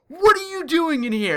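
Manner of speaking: high, loud mock-angry mom. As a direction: burst in yelling like an outraged mother. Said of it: "She was so angry and so pissed off".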